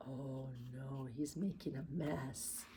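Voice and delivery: very soft, low murmur; hushed aside. Acted. Teacher to children about the puppy, hushed low aside. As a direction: murmur it in quiet dismay.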